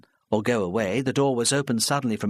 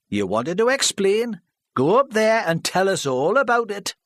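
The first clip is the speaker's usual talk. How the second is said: louder, higher scornful local man; scornful villager; 11 semitones higher, 5 dB louder than usual. Acted. Charlie scoffing at Mrs Parry before the hall, scornful. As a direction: scoff at her plan.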